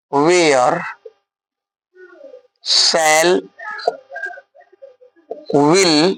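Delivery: each word set apart by long pauses; slowly, so pupils can write it down; slow dictation. Shown as a demonstration. Teacher to video students, dictating slowly. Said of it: "धीरे धीरे बोल रहे हैं आप लोग भी".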